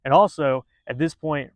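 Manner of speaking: usual speaking voice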